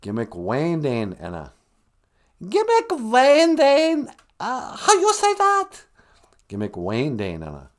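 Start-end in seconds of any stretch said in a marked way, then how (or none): 2.4-5.7 slow, loud falsetto learner; piercing high voice, drawn-out words; high-pitched guest character. Acted. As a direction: try it haltingly in a high character voice. Guest figure attempts the phrase.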